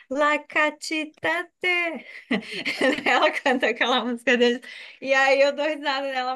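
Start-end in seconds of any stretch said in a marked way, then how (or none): 0.1-2.1 slow garbled "La Catita Te" sung loosely; "La Catita Te" drawn out like misheard Red Hot lyrics; mock sister mangling lyrics. Teacher mimics her sister singing Red Hot wrong, slow and garbled. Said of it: "que ela fala".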